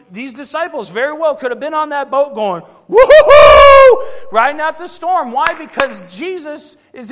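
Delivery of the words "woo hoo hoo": drawn-out high loud whoop, slow; joyful whoop. Pretend. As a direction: holler with joy through the storm. Disciples riding out the storm.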